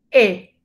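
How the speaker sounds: "E" with the mouth open downward, toward A; E con algo de A. Shown as a demonstration. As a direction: model a single open French "E". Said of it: "la boca más abierta". ("E" con la boca abierta hacia abajo)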